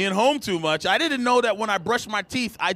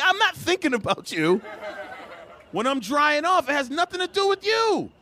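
high-pitched mock outrage; comic lovers'-quarrel protest; exasperated denial; 9 semitones higher than usual. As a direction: insist indignantly, voice rising at the end.

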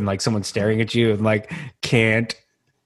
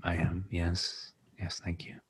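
whispered reply; 5 semitones lower, 15 dB quieter, slower than usual. Retold or imitated. Answering fan in dark theater.

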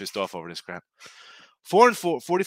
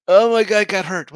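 high, slow, loud whine mocking a crybaby; hammed-up whiny gambler; mock whiny bettor; 9 semitones higher, 13 dB louder than usual. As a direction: whine it like a sore loser. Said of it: "this is the crap we gotta deal with".

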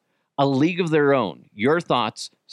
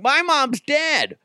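high, drawn-out mock wail; mock outraged outburst; much slower than usual. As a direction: play an offended listener, pitched way up. Mock outburst from offended listener.

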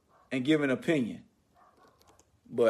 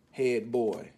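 ordinary talk, nothing marked

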